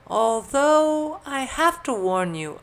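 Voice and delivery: put-on very high, loud girlish voice; cheerful warning. A narrator's reading. Elizabeth cautioning companions about the dog, cheerful high voice. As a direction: warn while still smiling. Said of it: "still smiling".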